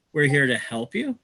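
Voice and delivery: ordinary talk, nothing marked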